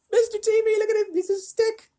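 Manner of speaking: extremely high, loud squeal; squeaky silly voice. Pretend. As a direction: squeal it in mock amazement. Squeaky joke showing stretched boy.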